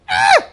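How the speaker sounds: sudden high yelp of disbelief; high exasperated cry. Real, not acted. Preacher reacting to the news, a high exclamation. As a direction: let out a high shocked cry.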